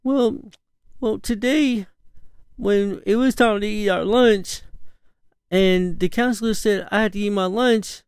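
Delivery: put-on high, whiny child explaining; imitating a sniffly kid; daughter voice. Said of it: "why are you crying".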